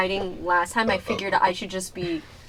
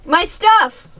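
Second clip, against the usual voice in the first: high, loud, acted delight; excited exclamation; 9 semitones higher, 7 dB louder than usual. Player as character finding lost gear, excited. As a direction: exclaim it like treasure just found.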